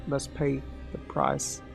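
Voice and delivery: ordinary delivery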